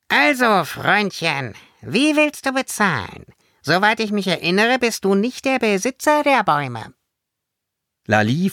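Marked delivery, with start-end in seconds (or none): 0.1-6.9 high, scolding fairy voice; pitched-up, sharp-tongued fairy reprimand; stern little fairy. A narrator's reading. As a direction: demand payment like an indignant tiny guardian. Fairy confronts the boy.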